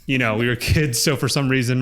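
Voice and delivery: ordinary talk, nothing marked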